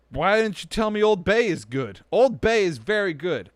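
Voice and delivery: loud, high mock indignation; mock outrage. Real, not acted. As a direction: shout it in playful outrage. Mock outrage about seasoning.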